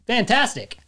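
high, loud and bubbly; cheerful. Pretend. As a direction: sell it like a great day. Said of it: "change it out for, like, fantastic".

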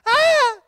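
sudden high, loud mock scream; scared scream. Preacher admitting his first instinct at the huge army, mock scared scream. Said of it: "I would be a little scared".

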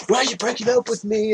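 quick high mock whine; lightly voiced pleading man.

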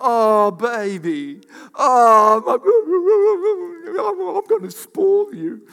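loud, high-pitched mock cooing; gushing baby talk. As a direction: wail exaggerated sympathy, climbing very high. Acts out gushing sympathy, babying someone.

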